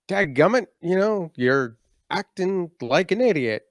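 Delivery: mock fed-up father; dad losing patience. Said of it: "he would say something like".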